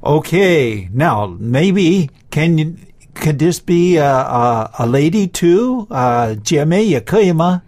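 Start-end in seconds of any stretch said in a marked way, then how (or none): none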